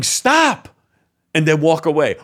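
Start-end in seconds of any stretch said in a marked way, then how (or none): 0.0-0.7 high yelled Stop; a sudden high shout of Stop; mock yell. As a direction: bark out Stop like a kid.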